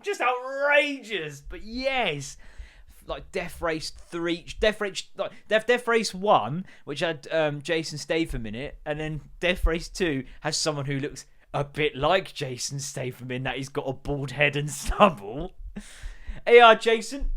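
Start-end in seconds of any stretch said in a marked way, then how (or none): 0.0-1.4 outraged. Retold or imitated. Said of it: "I'm like". 16.4-17.3 calls out loud and high to a mate; loud mock greeting. Calling out to Jason Statham, loud mock greeting.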